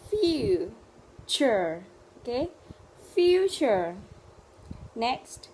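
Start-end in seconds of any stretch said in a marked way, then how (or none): 0.1-1.8 "Future" split into two slow, separated syllables. Shown as a demonstration. Teacher modelling a word for Vietnamese learners, syllable by syllable. 3.2-3.9 "Future" as one loud, connected word, high then falling; whole word. Shown as a demonstration. Teacher modelling a word for Vietnamese learners, whole word.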